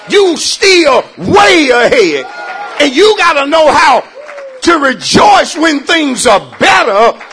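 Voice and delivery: loud, high, emphatic preacher delivery; full-force preaching. Real, not acted. Preacher urging saints to rejoice when things are better, full-force preaching. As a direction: preach it hard, punching the key words.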